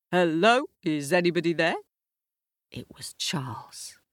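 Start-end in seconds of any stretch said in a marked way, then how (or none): 0.1-1.8 louder call into a dark house. Acted. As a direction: shout through the door at midnight. Charles calling at the door.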